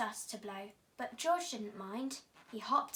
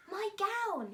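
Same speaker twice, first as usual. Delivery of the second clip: much higher, joyful shout; delighted yell; 9 semitones higher, 4 dB louder than usual. A narrator's reading. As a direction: cry out at finding a lost treasure. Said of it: "he yelled".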